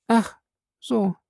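hoch und kleinlaut; plötzlich ertappt und klein; verlegen. A narrator's reading. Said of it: "sagte sie verlegen". (verlegenes, leiser werdendes „Ach so“)

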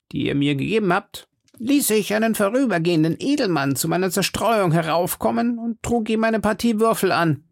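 high-pitched confessing musketeer; Porthos. Acted. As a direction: own up in a high character voice. Porthos confesses his gambling loss.

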